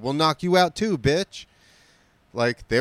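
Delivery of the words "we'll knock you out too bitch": threatening thug. Retold or imitated. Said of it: "And they said".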